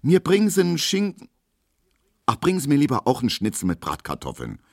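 high, then rushed change of mind; struggling with herself. Acted. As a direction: torn between ham and schnitzel, then hurry. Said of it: "wie sie dabei mit sich kämpft". (Hedwig ringt mit sich und bestellt dann hastig um)

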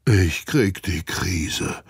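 slow, mildly exclaimed; calling out. A narrator's reading. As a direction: cry out in disbelief. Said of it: "ruft Olaf". (entgeistert ausgerufen)

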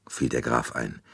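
ordinary delivery

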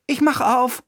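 put-on high, eager boy's shout; boy calling out. A narrator's reading. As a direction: call out like an excited boy. (hoher Jungenruf)

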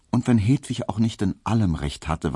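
usual speaking voice

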